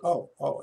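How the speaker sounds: pained oh. Retold or imitated. Reacting to the biopsy shot.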